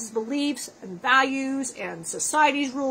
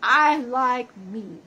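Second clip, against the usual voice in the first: slow, drawn-out refusal; much slower than usual. Retold or imitated. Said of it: "And I go".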